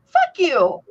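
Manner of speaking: high, loud startled snap; shocked outburst. Retold or imitated. Aunt reacting to the pot plant, loud shocked snap. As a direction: blurt it out in shock. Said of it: "I go, I go".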